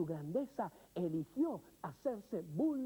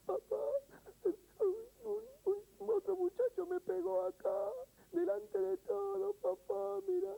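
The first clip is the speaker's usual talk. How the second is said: put-on high, tearful little boy sobbing to his dad; crying child; 11 semitones higher than usual. Son home from school crying to his father, high and tearful. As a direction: plead through tears like a hurt child. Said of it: "viene llorando y dice".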